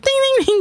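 imitated chime. Imitates the dropped-key sound.

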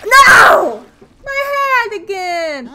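high, loud, slow wail; anguished cry. Real, not acted. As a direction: cry out at the lost hat. Hat lost again.

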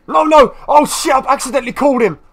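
high, loud alarmed cry of no; sudden high panic; panicked outburst. Real, not acted. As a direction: caught off guard by his own mistake. Panicked, accidentally called Trico.

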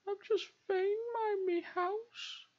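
very high, squeaky lament; mock wail. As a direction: wail it in a tiny high voice, hammed-up. Wailing over destroyed house.

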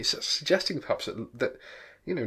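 ordinary delivery

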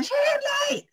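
calling out a name very high across the office; shouting a name. Retold or imitated. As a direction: yell the name to summon her from the back. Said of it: "y lo primero que hace es gritar". (grito agudo llamando a Shirley)

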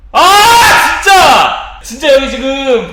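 loud high outburst; shocked shout. Real, not acted. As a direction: shout it out, still shaken. Right after the scream. (놀라서 외치는 소리)

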